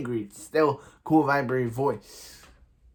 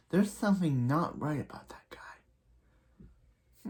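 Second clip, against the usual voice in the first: a higher, secretive imitated whisper; suspicious whisper; 4 semitones higher, 4 dB quieter, slower than usual. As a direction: breathe it like you're sharing a secret. Oliver's suspicious remark, quoted.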